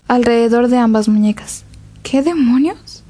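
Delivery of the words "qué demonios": slow startled question; surprised. A narrator's reading. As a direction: react with shocked disbelief. Shocked at bruised wrists.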